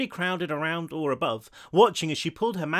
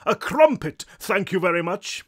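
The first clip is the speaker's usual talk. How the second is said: loud, high, prim English correction; booming English ghost; 4 semitones higher, 6 dB louder than usual. A narrator's reading. Bald ghost correcting the short one, grand English. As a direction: correct haughtily in an English accent. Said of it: "what might have been an English accent".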